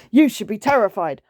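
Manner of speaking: loud menacing roar. Acted. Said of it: "roared the lion".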